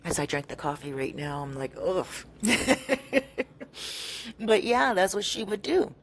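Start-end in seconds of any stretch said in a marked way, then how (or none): 1.8-4.3 a grossed-out "ugh" into laughter; groan, then crack up; disgusted laugh. Real, not acted. Storyteller drinking coffee during the pee story, amused disgust.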